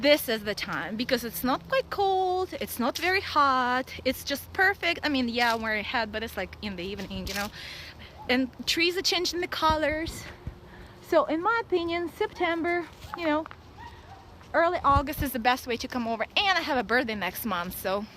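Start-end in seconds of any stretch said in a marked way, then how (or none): none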